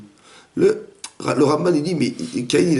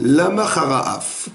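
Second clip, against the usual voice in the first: slow verse recitation; 2 semitones higher, much slower than usual. Shown as a demonstration. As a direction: read the Hebrew verse very slowly, weighing each word. (récitation lente du verset)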